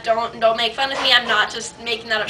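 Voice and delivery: normal talking voice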